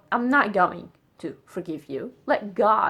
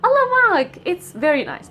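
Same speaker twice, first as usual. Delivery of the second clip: normal talking voice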